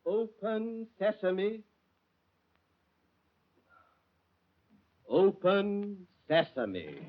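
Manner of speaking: very slow, careful "Open sesame"; slow drawn-out command. Acted. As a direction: stretch each syllable of "Open sesame". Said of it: "Confound you".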